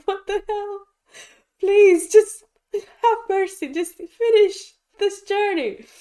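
very high, loud begging, slowing down; hammed-up wail begging for mercy; mock desperate pleading. Viewer begging the tense film to end, desperately pleading. Retold desperate plea while watching.

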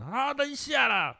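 high, slow whiny "wait a sec"; mocking a kid who won't come to dinner. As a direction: drag it out like a stalling child. Mimics the kid's stalling.

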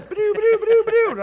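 high, loud mock volley of shots; gunshot noises. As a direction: fire off the shots like a kid playing cowboys. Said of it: "They're like".

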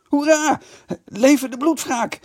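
very high, loud cheering shriek. A narrator's reading. Huib cheers the snowball fight with a shriek. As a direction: scream a wild battle cheer. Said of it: "gilde Huib Delfors".

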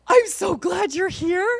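high, loud, drawn-out delight; gushing relief. Retold or imitated. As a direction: greet them with hugely relieved excitement, stretched out. Relieved greeting at the elevator.

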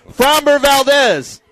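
high, drawn-out player announcement; calling out a name. Real, not acted. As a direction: stretch the name out for the crowd. Calling names for crowd applause.

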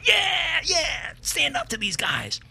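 high, slow mock cheer; hammed-up drunken cheering. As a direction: egg him on from across the room. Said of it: "she's giving him two thumbs up".

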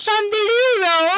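high, drawn-out mock British; British accent. Podcast host echoing the letter writer's slang, mock British accent. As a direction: tease it out in a put-on British accent. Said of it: "if I do my British accent".

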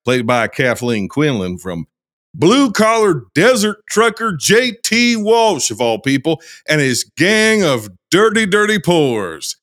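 put-on lively Southern-accented storyteller reading an intro. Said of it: "intro here in this accent".

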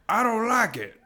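high, loud put-on complaint; mock refusal. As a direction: act out a hammed-up objection. Acted quote of actor quitting.

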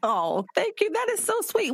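choked-up, high gratitude; real tears of being touched; tearful thanks. Real, not acted. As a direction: voice catching on thank you. Host moved by guest's words.

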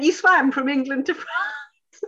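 high voice breaking into a laugh; laughing disbelief. Retold or imitated. Asking Louise in disbelief, laughing high. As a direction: laugh through the astonished question.

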